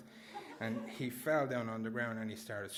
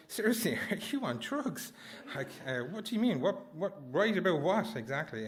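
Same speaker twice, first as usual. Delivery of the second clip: can't believe what he's hearing; incredulous; 5 semitones higher than usual. Retold or imitated. Boy to his father after nearly dying, incredulous and high.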